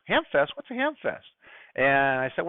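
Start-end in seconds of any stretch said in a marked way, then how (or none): 0.1-1.3 puzzled Australian. Retold or imitated. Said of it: "He goes".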